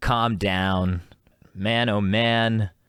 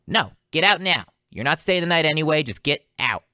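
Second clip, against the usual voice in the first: higher-pitched imitation of a fed-up girlfriend; mock angry order; 6 semitones higher than usual. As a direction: throw him out, lightly acted. Acted girlfriend throwing him out.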